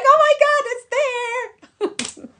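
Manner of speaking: very high, loud retold squeal breaking into laughter; high-pitched reenacted shriek of discovery; excited squeal. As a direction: squeal it out in amazed delight. Retells discovering her vision board match.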